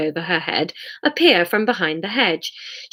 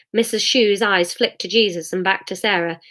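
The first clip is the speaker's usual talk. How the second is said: plain reading voice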